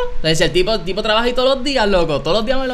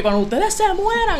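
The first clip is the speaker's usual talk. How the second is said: mock janitor threat; 11 semitones higher than usual. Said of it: "lo dijo en general".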